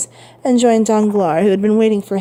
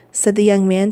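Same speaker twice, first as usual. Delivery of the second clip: ordinary delivery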